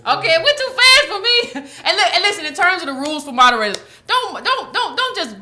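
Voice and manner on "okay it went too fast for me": laughing. Real, not acted. Laughing at her own mix-up.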